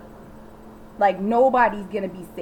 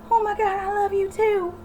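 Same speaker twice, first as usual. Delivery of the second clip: high, swooning gush; mock gushing; 11 semitones higher than usual. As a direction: gush like a smitten girl, pitched high. Mocking Nicole's gushing reply.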